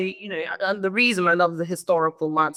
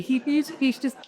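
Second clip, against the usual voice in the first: ordinary delivery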